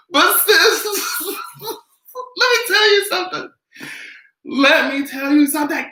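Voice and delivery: high, breaking with laughter; laughing through it. Real, not acted. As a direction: squeak it out mid-laugh.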